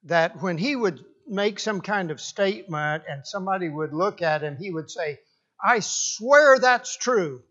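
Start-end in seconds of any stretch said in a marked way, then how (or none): 5.6-7.4 slow, raised, louder oath; emphatic swearing. Retold or imitated. Preacher quoting a known liar, insistent swearing. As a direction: quote him swearing it, drawn out and insistent.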